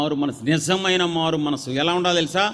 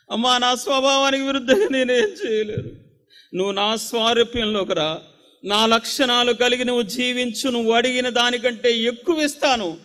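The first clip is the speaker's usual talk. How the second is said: high, loud impassioned preaching; fervent pastor pleading with his church in God's voice; preaching at full force; 8 semitones higher, 5 dB louder than usual. Acted. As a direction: cry out to the congregation, pleading and urging.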